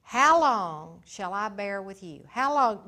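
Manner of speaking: loud, slow, drawn-out lament; weary exasperation. Acted. Jesus asking how long he must bear them, loud and weary. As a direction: let the frustration boil over.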